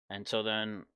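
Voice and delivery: usual speaking voice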